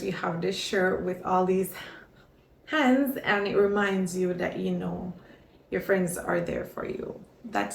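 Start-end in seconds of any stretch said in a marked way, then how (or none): none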